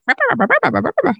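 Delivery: rapid bubbly imitated hen burble; hen chatter imitation. Owner mimicking a hen being picked up, chattering cluck. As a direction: babble like a contented hen in quick repeated syllables. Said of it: "she's like".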